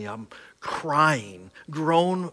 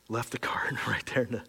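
real emotion, breath-only whisper; fighting back tears; choked-up whisper; 2 semitones lower, 6 dB quieter than usual. Real, not acted. Pastor moved by his own story, whispering through tears.

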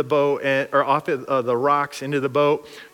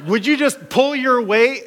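higher, louder exasperated shout; exasperated demand; 8 semitones higher, 3 dB louder than usual. Retold or imitated. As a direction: snap at him to help. Disciples demanding of Jesus.

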